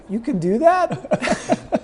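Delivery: high, slow, laughing disbelief; stunned pitcher laughing in disbelief; amazed disbelief. Retold or imitated. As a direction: stretch it out in wide-eyed shock, laughing.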